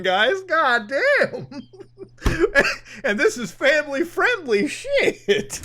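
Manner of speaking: ordinary talk, nothing marked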